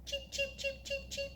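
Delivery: very high, soft chick peeps; baby chick cheeping. Shown as a demonstration. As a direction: peep like a tiny chick. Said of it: "Can you cheep like a baby chick?".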